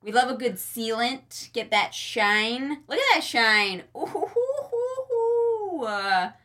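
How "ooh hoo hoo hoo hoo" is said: high, whooping hoots; excited high hoots. Real, not acted. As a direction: hoot in delight, high. Excited admiring shine.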